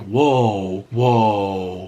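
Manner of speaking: long, stunned whoa; drawn-out whoa. Retold or imitated. Reacting to girlfriend's news, with a stretched stunned whoa. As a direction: stall in shock.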